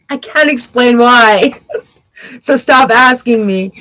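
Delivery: usual speaking voice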